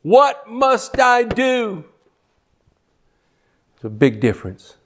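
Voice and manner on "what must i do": higher, slow desperate question; a broken, pleading cry; broken plea. Retold or imitated. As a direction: ask like a humbled sinner. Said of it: "the sinner is convicted and broken and humbled".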